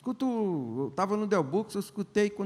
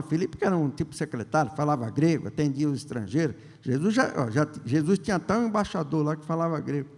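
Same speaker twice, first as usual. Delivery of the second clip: usual speaking voice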